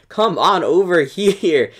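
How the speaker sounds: a slow, laughing come-here taunt. Pretend. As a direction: coax them over with a laugh. Luring troops, laughing.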